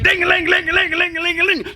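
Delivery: high, fast bell jingle; ringing bells. Acted. Women ringing bells to wake the sun, high jingle. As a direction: tinkle it out like little bells.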